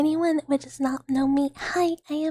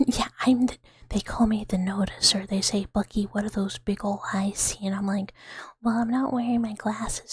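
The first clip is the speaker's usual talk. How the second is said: low, breathy near-whisper; 5 semitones lower than usual. Real, not acted. ASMR streamer chatting softly.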